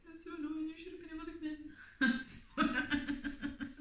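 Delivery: high, small whiny voice; emotional upset. Retold or imitated. Upset wife rings husband who was kept out, tearful whine. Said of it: "I got, like, so emotional".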